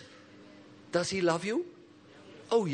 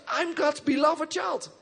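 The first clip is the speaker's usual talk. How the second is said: full of faith; 10 semitones higher than usual. Shown as a demonstration. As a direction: proclaim it like a victory. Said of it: "This is faith which beats enemies".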